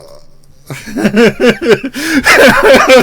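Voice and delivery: high, loud burst of laughter; loud laughing fit. Real, not acted. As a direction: crack up completely. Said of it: "I'm laughing".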